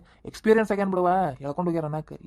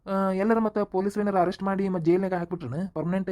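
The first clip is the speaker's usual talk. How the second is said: normal talking voice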